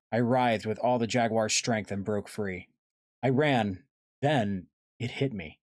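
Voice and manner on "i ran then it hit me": ordinary delivery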